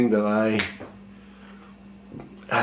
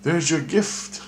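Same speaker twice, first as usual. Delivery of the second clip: a slow, higher-pitched voice, lightly voiced; Christ's voice; 8 semitones higher, slower than usual. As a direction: hand over the gift slowly from a higher pitch. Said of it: "Christ goes".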